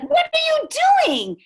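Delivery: very high, loud, shocked father; retold shriek of outrage; acted outraged shout. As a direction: yell in horrified disbelief. Father shocked at coat ripping.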